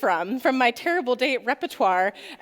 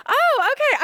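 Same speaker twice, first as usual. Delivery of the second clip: surprised; 12 semitones higher, 5 dB louder than usual. Retold or imitated. Reacting to relative's controversial talk.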